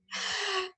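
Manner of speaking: short, high giggle; soft high-pitched laugh. Real, not acted. Speaker amused at her own two difficult words, short giggle.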